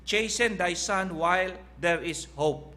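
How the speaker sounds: slow, weighty reading of the verse; slow scripture reading. Acted. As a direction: lay each word of the verse down slowly. Reads Bible verse in English.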